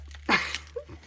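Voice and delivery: breathy, voiceless chuckle; amused laugh. Real, not acted. Mom laughing after mimicking her kid, breathy chuckle. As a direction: laugh at your own impression.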